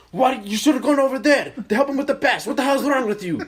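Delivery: high, loud coaching rant; dad yelling. Retold or imitated. Dad berating his son's team after bad pass, yelling. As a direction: berate the players at full volume. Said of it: "He was yelling at the people".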